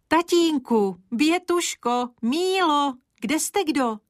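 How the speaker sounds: high, astonished calling out of names; a teacher caught completely off guard; delighted disbelief, voice flying up; amazed exclamation. A narrator's reading. Teacher amazed at mushroom gift.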